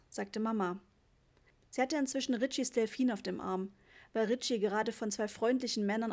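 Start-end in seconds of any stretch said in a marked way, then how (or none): none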